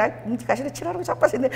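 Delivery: soft Busan dialect teasing, falling at the end; friendly Busan banter. Retold or imitated. Close friends teasing each other, in familiar Busan dialect. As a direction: toss off a Busan insult between close friends. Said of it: "이게 엄청 친한 사이야".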